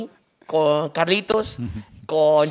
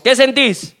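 raised-pitch comic questioning; insistent doctor question; 4 semitones higher, 3 dB louder, much slower than usual. Acted. Joke doctor asking his patient mid-exam, insistent. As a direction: press the patient for an answer.

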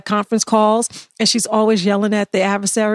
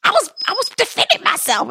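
mocking defensive client; 11 semitones higher than usual. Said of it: "And I'm like".

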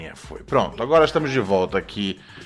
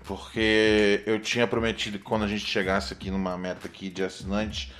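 ordinary delivery

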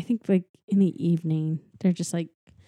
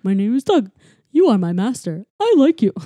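eager dog introducing himself, loud; 3 semitones higher, 8 dB louder than usual. Retold or imitated. Voicing Doug the dog.

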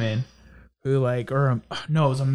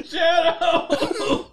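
high, hammed-up wail; mock anguish; 13 semitones higher than usual. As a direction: wail the dog's name in fake despair. Acting out movie mashup scene.

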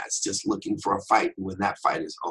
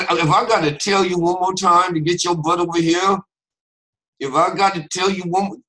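high, loud threat through a barely moving mouth; tight-jawed, mock-angry parent; mouth not moving; 9 semitones higher, 6 dB louder than usual. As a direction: warn a child through clenched teeth. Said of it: "when the mouth doesn't move".

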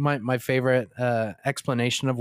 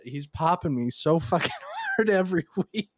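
laughing; 6 semitones higher than usual. Real, not acted. Laughing about rival's weekly attacks.

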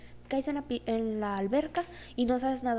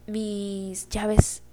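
sudden alarm; 8 dB louder than usual. Retold or imitated. Realizes she forgot keys.

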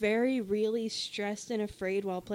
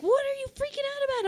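much higher, quick incredulous question; Hannah, baffled; faster than usual. Retold or imitated. Onlooker asking the panicking player, high and baffled. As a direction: imitate a bewildered onlooker, pitched way up. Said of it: "And Hannah's like".

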